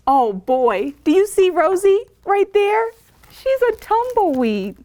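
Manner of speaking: plain reading voice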